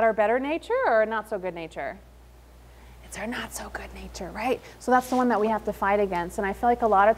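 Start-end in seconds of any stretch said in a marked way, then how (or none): 3.0-4.3 whispered aside; hushed confession. Real, not acted. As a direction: lean in and whisper it. Confiding to audience.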